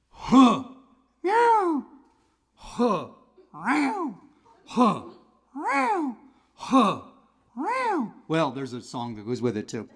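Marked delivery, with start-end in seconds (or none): 0.1-7.0 rhythmic chain gang grunts; work grunts. Acted. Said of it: "in a chain gang". 7.6-8.1 high, strained meows between grunts; chain gang cat. Acted. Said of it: "cat in a chain gang".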